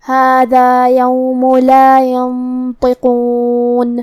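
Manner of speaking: very slow melodic tajweed recitation; Quran recitation. Shown as a demonstration. Teacher recites the verse slowly before explaining it. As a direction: chant it slowly, stretching the vowels. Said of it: "تو شروع کرتے ہیں".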